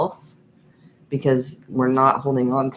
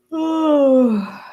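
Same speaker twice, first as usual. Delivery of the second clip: loud high hiccup yelp; a real hiccup popping out; 12 semitones higher, 6 dB louder than usual. Real, not acted. Reader hiccups again while talking to viewers. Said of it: "excuse me".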